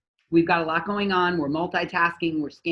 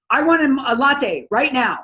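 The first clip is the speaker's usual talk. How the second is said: mock rude order; 7 semitones higher, 7 dB louder than usual. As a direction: bark the order like an entitled customer. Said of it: "We don't barge up to the thing".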